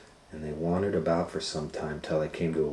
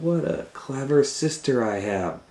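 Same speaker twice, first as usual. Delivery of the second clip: higher proud praise; lightly voiced happy boy; admiring; 7 semitones higher than usual. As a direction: praise his sister. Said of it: "And Hansel said".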